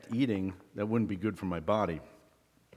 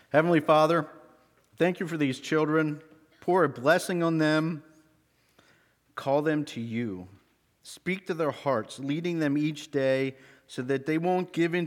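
prayer; 4 semitones higher than usual. Acted. Teacher prays over the children.

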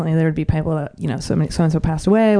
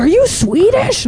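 high, slow questioning quote; imitated nosy resident; 12 semitones higher, slower than usual. Retirement home residents quizzing the waitress, high mock question. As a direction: ask it high and drawn out. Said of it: "they're always like".

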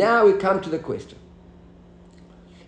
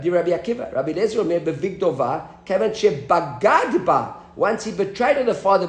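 ordinary talk

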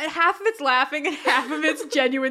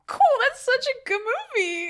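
high mock gush; 11 semitones higher, 4 dB louder than usual. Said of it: "and I'm like".

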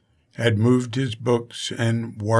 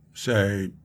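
ordinary talk, nothing marked

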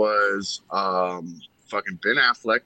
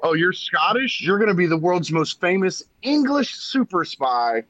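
much higher, mock-incredulous retelling; Sean Connery; 9 semitones higher than usual. Scottish star cast as English super spy, mock-defiant. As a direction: act out the absurd casting, pitched right up. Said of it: "he's like".

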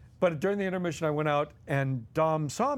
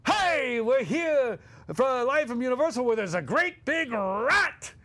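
much higher, louder, hammed-up showman; Dom's TV voice; 9 semitones higher, 4 dB louder, slower than usual. Dom going live on morning TV, hammed-up host voice. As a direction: burst on air like a manic host. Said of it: "Dom DeLuise goes off".

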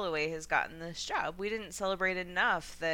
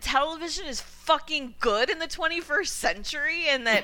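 indignant, higher and louder; pissed-off rant; 7 semitones higher, 5 dB louder than usual. Real, not acted. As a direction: vent real exasperation, pushing the key words. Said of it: "I'm really pissed about this".

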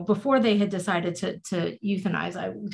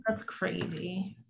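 stunned hush; 11 dB quieter, slower than usual. Real, not acted. Stunned reaction.

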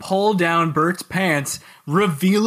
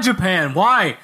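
usual speaking voice

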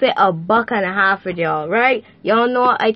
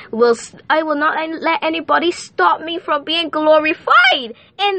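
higher, rising into a fervent declaration; declaring a decree; 5 semitones higher than usual. Acted. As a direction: proclaim it like a testimony in church. Declares and decrees before the Lord.

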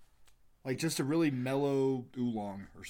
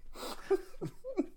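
a high airy burst of laughter; high breathy laugh; 6 dB quieter than usual. Real, not acted. Host laughs at his own dirty joke, high breathy laugh.

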